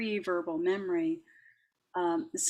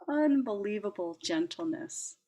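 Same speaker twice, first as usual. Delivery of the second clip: normal talking voice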